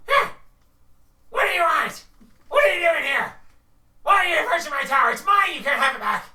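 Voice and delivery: shrieking high kobold yelling at intruders; angry kobold. Acted. Kobold guarding his tower shouts at the party, high and furious. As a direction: screech it out like a territorial little kobold. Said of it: "middle-aged kobold".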